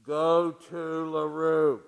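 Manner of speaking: slow, soft, otherworldly call; voice in a dream. Retold or imitated. Pastor mocking a divine summons he never got, slow and soft. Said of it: "in a dream".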